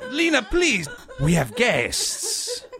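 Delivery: high, pleading hush to Lena; an embarrassed host reining in a noisy woman; embarrassed plea. Acted. Host embarrassed before his guests, pleading with Lena.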